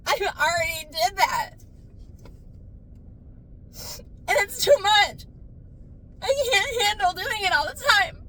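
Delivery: wailing, very high crying outburst; hysterical crying. Acted. Breaking down in the parked car, hysterical wail. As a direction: sob it out at the top of your range. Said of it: "I'm gonna lose it".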